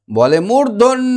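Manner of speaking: high, slow, drawn-out মূর্ধন্য; stern rhyme voice. Shown as a demonstration. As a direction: stretch মূর্ধন্য like a scolding teacher. (উঁচু সুরে টেনে টেনে মূর্ধন্য)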